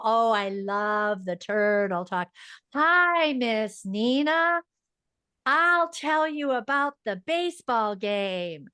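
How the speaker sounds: exaggerated slow, stretched-out therapy speech; turtle talk. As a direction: mimic a child dutifully doing turtle talk, every word dragged out. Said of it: "turtle talk outside of a therapy session".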